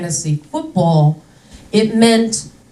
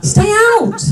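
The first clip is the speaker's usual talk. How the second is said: exasperated rant; 12 semitones higher than usual. Real, not acted. Comedian scolding shark survivors, exasperated and high. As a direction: scold them, pitch way up and laughing. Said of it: "comedian ranting at shark survivors".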